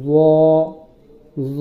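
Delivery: "জ" as heavy "dho", tongue tip on upper teeth; thick zo. Shown as a demonstration. Teacher demonstrating the letter za, heavy dental sound. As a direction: say "জ" full and thick with a voiced TH. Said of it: "মোটা করে বলতে হবে".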